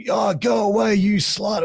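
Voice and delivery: high-pitched imitation of a front-row heckler; hammed-up crowd insult; mock heckling.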